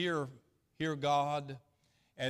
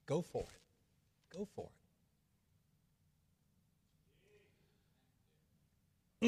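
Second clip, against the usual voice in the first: quiet blessing; 2 semitones higher, 7 dB quieter than usual. Retold or imitated. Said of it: "and he said".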